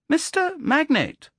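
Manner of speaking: put-on high, slow, precise child's voice; a clever boy speaking up neatly; precise boy's treble. A narrator's reading. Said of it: "John's curiously precise treble".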